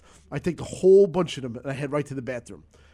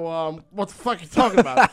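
louder fake innocence breaking into laughter; laughing mock denial; 3 semitones higher, 7 dB louder than usual. Denying to his wife, laughing through the mock innocence. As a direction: play dumb while cracking up. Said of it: "With a straight out face".

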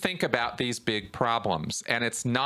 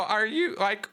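exasperated, climbing much higher in disbelief; rising pitch, incredulous at a silly theory; voice gets high; 10 semitones higher than usual. Retold or imitated. Arguing with conspiracy theorists, exasperated high-pitched disbelief. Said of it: "my voice gets high".

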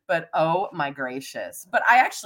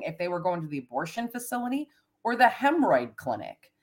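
usual speaking voice

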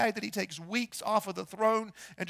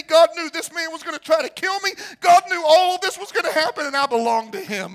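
impassioned crescendo, louder and higher; full-force preaching; 4 semitones higher, 10 dB louder than usual. Acted. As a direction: build to a shouted declaration of conviction.

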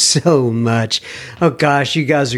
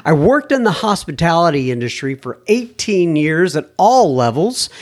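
lightly raised reading voice; email read aloud; 3 semitones higher than usual. Acted. Host reads listener email to audience, lightly raised voice. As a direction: read the letter like you're quoting him.

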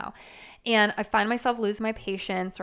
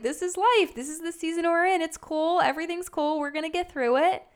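higher, cheerful and carefree; breezy laugh-it-off; 6 semitones higher than usual. Retold or imitated. Mom laughing off crying kids, breezy and higher. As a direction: shrug it all off with a bright lift. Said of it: "laughing it off. Like".

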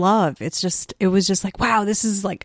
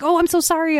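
much higher, sheepish apology; high apologetic voice; 10 semitones higher, faster than usual. Retold or imitated. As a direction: quickly say sorry. Guard apologizing.

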